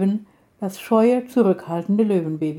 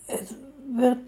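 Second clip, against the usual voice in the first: softer, weak reply; softly; 4 semitones higher, 6 dB quieter than usual. A narrator's reading. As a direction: barely voice the words, soft. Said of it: "erwiderte er leise". (leise und schwach)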